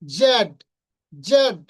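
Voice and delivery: "জেড" loud and distinct, one take at a time; emphatic teacher's model of "জেড"; correct letter name. Shown as a demonstration. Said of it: "এটা সঠিক উচ্চারণ কি". ("জেড" জোরে স্পষ্ট করে)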